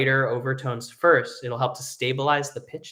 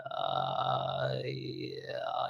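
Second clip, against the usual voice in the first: vocal fry; 10 dB quieter than usual. Shown as a demonstration. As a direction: let the voice crackle in fry. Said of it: "work on vocal fry".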